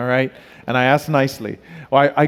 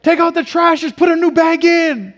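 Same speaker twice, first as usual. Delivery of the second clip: put-on much higher, louder yelling at the kid; exasperated parent yell; 7 dB louder than usual. Fed-up dad yelling at his son about the trash bag, loud and high. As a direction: bellow it like a fed-up dad hollering upstairs. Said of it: "I'm like".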